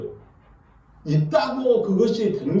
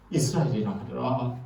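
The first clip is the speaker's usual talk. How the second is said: much lower, soft, solemn pronouncement; voice of God; 9 semitones lower, 6 dB quieter than usual. Retold or imitated. God giving Jacob a new name, deep and solemn. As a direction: drop low and quiet like God decreeing.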